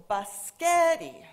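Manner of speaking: "biscotti" as buh-SKEH-dee, middle syllable loud and long; slow, loud punch on the middle of "biscotti"; middle-syllable stress. Shown as a demonstration. Teacher shows learners the child's word, stressed middle syllable. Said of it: "the center syllable was pronounced louder, longer, and higher".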